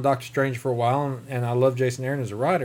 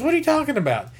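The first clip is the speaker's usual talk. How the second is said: incredulous; 11 semitones higher, faster than usual. Retold or imitated. Strange incredulous retold.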